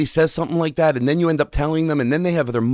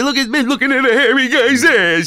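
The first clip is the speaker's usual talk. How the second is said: mock the hack comic, louder and higher, playing it up for the crowd; hammed-up mock comic; 10 semitones higher, 5 dB louder than usual. Imitating a stereotypical comic's joke.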